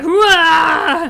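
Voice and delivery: barked battle cry hooah; loud gung-ho soldier. Retold or imitated. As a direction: shout it like a charging marine. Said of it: "He's like".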